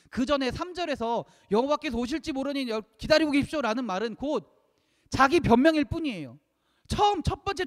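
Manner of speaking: loud, high-pitched impassioned preaching. Real, not acted. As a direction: drive the argument home at full voice. (높고 힘차게 외치는 설교)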